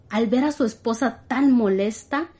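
ordinary delivery, nothing marked

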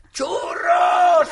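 loud, high call for churros; royal bellow. Pretend. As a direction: yell across the palace. Reina grita desde el palacio. (reina gritando que quiere churros)

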